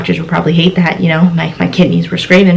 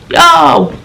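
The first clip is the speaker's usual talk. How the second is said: higher-pitched retold call; raised call; 8 semitones higher than usual. Student calls to roommate in the shower, mock raised call. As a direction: call out to be heard through a door. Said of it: "hear me a little louder".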